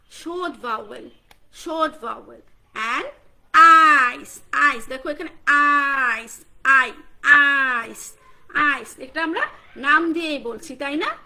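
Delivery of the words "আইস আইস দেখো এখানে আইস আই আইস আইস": আইস called out loud and slow, vowel stretched; আইস, long drawn vowel for kids. Shown as a demonstration. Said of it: "এটা হচ্ছে long vowel".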